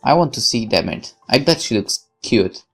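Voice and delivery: ordinary delivery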